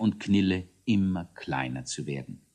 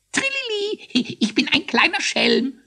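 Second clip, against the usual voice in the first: very high, squeaky sprite voice; jubilant little ghost; 3 dB louder than usual. A narrator's reading. Little ghost cheers before the court, jubilant. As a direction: trill it out with impish glee. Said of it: "jubilierte der kleine Geist".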